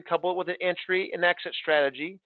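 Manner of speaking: normal talking voice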